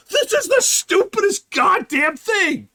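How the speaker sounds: high, loud frustrated shout; exasperated yell. Retold or imitated. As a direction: yell at the screen in disbelief. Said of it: "I'm staring at the screen like".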